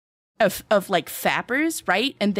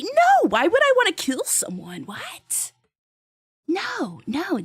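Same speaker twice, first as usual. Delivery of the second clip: incredulous denial; 8 semitones higher, slower than usual. Real, not acted. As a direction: squeal it in disbelief, very high at the end. Shocked denial of killing anyone.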